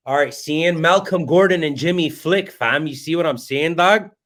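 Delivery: put-on Toronto slang accent, hyped up; playful Toronto mans accent. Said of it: "That's my, that's my Toronto accent".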